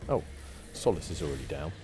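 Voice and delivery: ordinary talk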